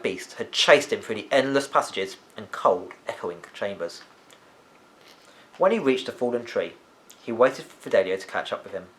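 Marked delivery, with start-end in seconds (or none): none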